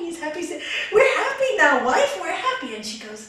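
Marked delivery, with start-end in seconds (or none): 0.9-2.7 loud, high, laughing exclamation; storyteller's overjoyed husband, voice raised; delighted husband. Acted. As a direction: cry it out with glee. Husband delighted with new cottage.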